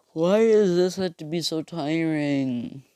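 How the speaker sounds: slow sigh-and-yawn delivery. Retold or imitated. Deku yawning about how tiring it is, slow. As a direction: let a yawn stretch the words out. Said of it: "As he yawns and says".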